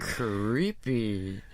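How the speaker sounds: low, drawn-out villain voice; cartoon villain voice. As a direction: growl it like a cartoon baddie. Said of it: "The cartoon villain voice is funny".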